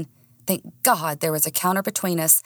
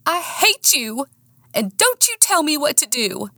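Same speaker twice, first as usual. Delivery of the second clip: high-pitched, defiant outburst; angry defiance; 9 semitones higher than usual. A narrator's reading. Woman struggling in Nick's grip, defiant. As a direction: spit defiance with pitch raised.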